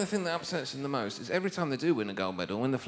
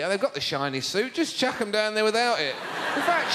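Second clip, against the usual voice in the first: ordinary talk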